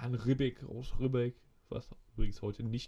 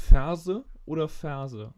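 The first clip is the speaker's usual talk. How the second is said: "Ferse oder Ferse" drawn out and loud for a bad line; slow it right down and call it out loud; repeating the quiz word loud and slow over a bad connection; 10 dB louder, much slower than usual. Shown as a demonstration. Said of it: "Ich verstehe dich nicht".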